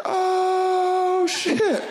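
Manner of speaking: high, loud startled cry; shocked oh shit. Retold or imitated. Tripper startled by the greeting, high shocked yelp. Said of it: "And I was like".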